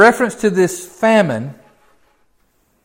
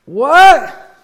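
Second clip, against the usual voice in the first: high, loud startled What?; mock shock; 9 semitones higher, 7 dB louder than usual. Preacher mocks a believer hit by trouble, startled high cry. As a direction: play the naive believer caught off guard. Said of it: "you don't just go".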